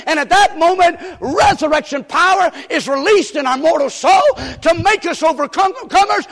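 building to a loud, high climax; preaching at full force. Acted. Preacher climaxing about resurrection power, full force.

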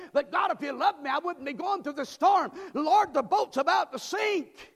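loud, high pleading complaint; a shouted frightened plea; panicked disciples. Retold or imitated. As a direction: cry out to God from the sinking boat. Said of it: "I can hear some disciples saying".

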